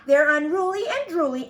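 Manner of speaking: high, loud dramatic complaint. A narrator's reading. Mama cat warns her kitten about dogs, dramatic. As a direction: declaim it like an overdramatic mama. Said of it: "and she added dramatically".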